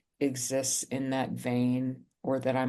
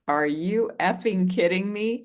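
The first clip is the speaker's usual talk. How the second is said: higher, louder exasperated yell; retold outburst; 5 semitones higher, 4 dB louder than usual. Snapping at her mother on the phone, higher and louder in exasperation. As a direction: throw her mother's advice back at her, fed up. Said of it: "I flipped out".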